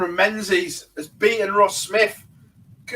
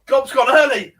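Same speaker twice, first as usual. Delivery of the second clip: excited; 6 semitones higher than usual. Real, not acted. Teasing Gob for answering early.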